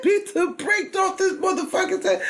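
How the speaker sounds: laughing. Real, not acted. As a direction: crack up while telling it.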